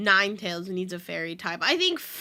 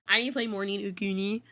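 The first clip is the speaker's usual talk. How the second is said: normal talking voice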